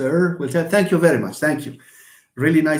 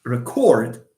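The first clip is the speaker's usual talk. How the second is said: "record" as re-CORD, louder on the end; stress on second syllable; 5 semitones lower, 4 dB louder than usual. Shown as a demonstration. Teacher demonstrates the stressed syllable, "record" with a strong low ending. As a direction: keep "re" light and hit "cord" in "record". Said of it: "it's this syllable that is stressed".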